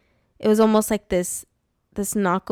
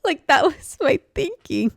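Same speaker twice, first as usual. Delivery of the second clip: laughing excitedly; 6 semitones higher, 7 dB louder than usual. Real, not acted. Laughing at her childish plan.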